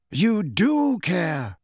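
put-on steady old headmaster, unhurried; calm reply. A narrator's reading. Dumbledore calmly contradicting the raging boy, very slow. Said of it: "said Dumbledore".

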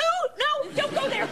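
very high alarmed squeal; horrified, hammed-up refusal; panicked protest. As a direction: cut them off in high-pitched panic. Panics at talk of dying.

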